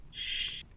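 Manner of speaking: very soft, long shush; gentle drawn-out shh; soothing shush. Acted. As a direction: hush someone who is panicking. Hushing a distressed listener.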